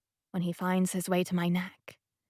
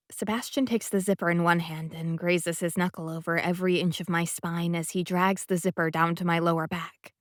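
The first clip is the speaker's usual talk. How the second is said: usual speaking voice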